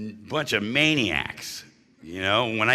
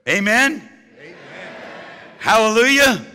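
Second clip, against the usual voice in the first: higher, louder preacher call to the room; revival call-out; 9 semitones higher, 4 dB louder than usual. Real, not acted. Speaker at an AA meeting rousing the crowd, loud revival call.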